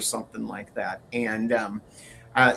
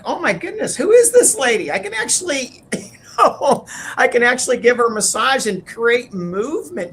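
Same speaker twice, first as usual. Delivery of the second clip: high, delighted disbelief, laughing midway; amazed surprise; 10 semitones higher, 6 dB louder than usual. Retold or imitated. Wife recalling massaging her husband's shoulders, high amazed surprise. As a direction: gasp at the discovery, way up high. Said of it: "all of a sudden I was like".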